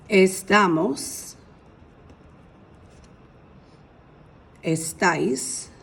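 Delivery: "Estamos" and "Estáis" said slowly with the Spanish stress; slow careful Spanish. Shown as a demonstration. Teacher sounding out estar forms for students, slow. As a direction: sound out each form. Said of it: "See this accent over the A?".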